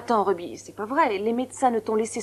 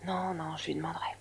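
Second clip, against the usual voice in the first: softer, whispered reassurance; hushed reassuring whisper; 4 semitones lower, 10 dB quieter than usual. Real, not acted. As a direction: promise it under your breath. Reassuring secretly in reply.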